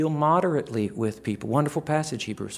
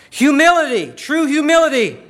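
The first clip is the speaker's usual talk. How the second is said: proclaimed word; 14 dB louder than usual. Acted. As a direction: call out the virtue loud and high. Proclaiming next virtue.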